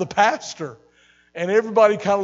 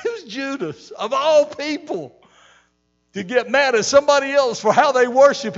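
much higher, louder preacher's cry; fired-up preaching; 8 semitones higher, 7 dB louder than usual. Real, not acted. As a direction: preach it hard to the congregation. Preaching at full force.

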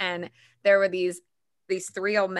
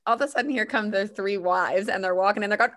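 normal talking voice

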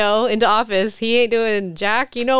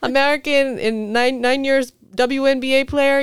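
mocking Russian accent, raised pitch; 5 semitones higher than usual. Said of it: "I like your Russian accent".

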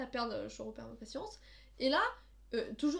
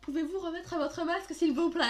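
higher, louder mock indignation; mock outraged repeat; 5 semitones higher, 6 dB louder than usual. Sister echoes the lady's outraged repetition, mock indignant. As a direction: repeat it as if insulted. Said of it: "elle a répété ma phrase comme si je l'avais insultée".

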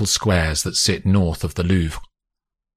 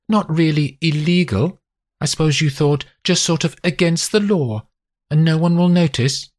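higher, mocking wife's voice; mock his excuse, pitched up; sarcastic wife; 11 semitones higher, 3 dB louder than usual. A narrator's reading.

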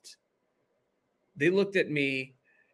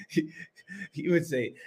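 ordinary talk

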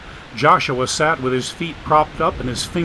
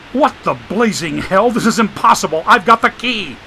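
angry outburst; 6 semitones higher, 5 dB louder than usual. Acted. Dagan locked in the closet.

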